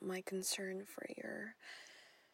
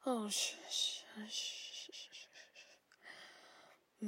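shushing; 4 dB quieter, slower than usual. Acted. Calming him down with shushing.

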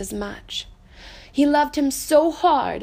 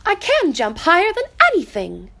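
a high, louder boast from the little rabbit; 7 semitones higher, 5 dB louder than usual. Acted. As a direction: brag to hide that you can't. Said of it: "said little Rabbit".

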